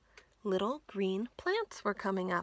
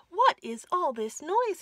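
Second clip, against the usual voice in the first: higher and louder, startled by the noise; alarmed; 8 semitones higher, 8 dB louder than usual. Acted. Frog running up to the shouting Toad, alarmed. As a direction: ask as if startled awake.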